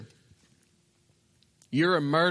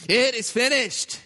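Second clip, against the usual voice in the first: acted cry of triumph, pitched up; raised, ringing declaration; loud proclamation; 5 semitones higher than usual. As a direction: proclaim it out over a crowd. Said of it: "in a loud voice of proclamation".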